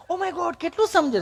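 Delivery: high-pitched mock city visitor; put-on high, precise city voice; affected educated Gujarati. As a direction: mimic a prim, over-enunciating tourist. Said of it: "ચીપી ચીપીને Gujarati બોલે".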